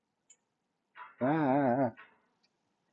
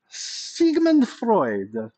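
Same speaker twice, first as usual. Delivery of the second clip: put-on slow, drawn-out, higher German-accented Sigmund Freud; hammed-up German accent, stretching each syllable; mock German accent; 8 semitones higher, much slower than usual. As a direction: lecturer jokingly doing Freud's name in a German accent. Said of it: "We have to do it in a German accent".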